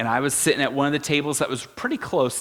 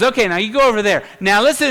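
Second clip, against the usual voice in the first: fast, higher, louder instructions; quick orders; 7 semitones higher, 6 dB louder, faster than usual. Retold or imitated. Speaker orders the volunteer, fast and loud. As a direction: rattle off commands.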